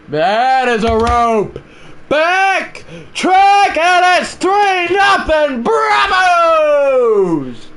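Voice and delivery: screaming, high, drawn-out announcer call; play-by-play call. Acted. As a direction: build from the crack of the bat to a roar. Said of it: "My commentary has been sounding a bit weird".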